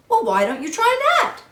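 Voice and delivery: ordinary delivery, nothing marked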